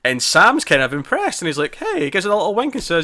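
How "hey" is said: playful high "hey". Retold or imitated. Retold Sam praising Ruth with wink.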